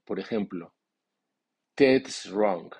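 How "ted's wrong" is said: "Ted's" stressed strongly, falling after it. Shown as a demonstration. Teacher models the noun's accent for learners, stress on "Ted's". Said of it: "el nombre lleva acento fuerte".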